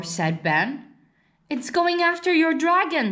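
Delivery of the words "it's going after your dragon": alarmed warning. Acted. Carl warning Max about the dinosaur cloud, higher and urgent. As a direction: call out the danger to your brother.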